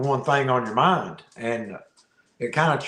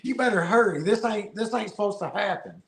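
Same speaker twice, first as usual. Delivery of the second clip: excited; 7 semitones higher than usual. Retold or imitated. Retold urgent plea while laughing.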